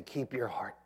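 soft whispered words; quiet unvoiced whisper. Shown as a demonstration. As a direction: whisper it to your neighbour. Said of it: "look at somebody next to you and say".

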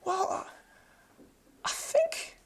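soft, hesitant doctor with long pauses; hushed, cautious delivery; measured, tentative. Retold or imitated. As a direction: imitate a careful friend, choosing each word. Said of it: "he's a very measured kind of".

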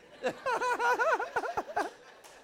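sudden high burst of laughter. Real, not acted. Preacher laughing at his own mantra, high and bright. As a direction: break into a delighted laugh.

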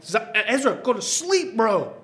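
exasperated dad begging; frustrated plea. Retold or imitated. Dad to squirming baby Ezra, exasperated high plea. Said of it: "And I'm like".

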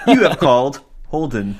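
automated voicemail greeting; voice message recording. Shown as a demonstration. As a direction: sound like a phone recording. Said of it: "I sounded like a voice message recording".

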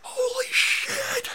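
high, slow, breaking with laughter; stunned giddy holy shit; laughing disbelief. Retold or imitated. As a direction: gasp it out half laughing, half crying. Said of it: "I was standing there just going".

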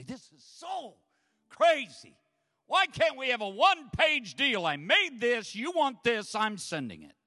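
exasperated rant. Retold or imitated. Pastor venting about his taxes, exasperated. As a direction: vent at the paperwork. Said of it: "I'm just wanting to say".

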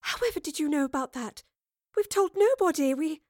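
high-pitched, astonished question; flustered disbelief; gasping shock. A narrator's reading. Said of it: "Jane gasped".

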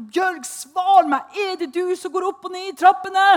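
loud, high call to the housemate on the stairs; frightened shout. Retold or imitated. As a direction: shout up the stairs, scared and demanding. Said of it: "Jeg ropte".

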